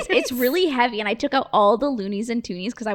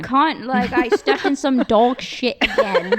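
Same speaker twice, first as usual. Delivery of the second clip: hammed-up Irish-Australian mixture of an accent; muddled Australian accent. Showing friends casual Australian swearing, muddled mock Australian accent. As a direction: attempt an Australian accent, drawn out and laughing. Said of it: "I don't know what accent that was".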